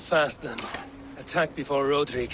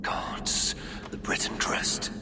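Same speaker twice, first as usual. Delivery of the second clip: whispering; 4 semitones lower, 3 dB quieter than usual. Acted. Examining the dagger alone.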